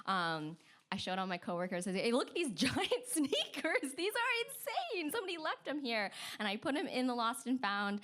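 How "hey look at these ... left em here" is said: high-pitched, laughing disbelief; amazed excitement. Retold or imitated. Receptionist shows coworkers giant sneakers, high excited amazement. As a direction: show off an absurd find, voice leaping up.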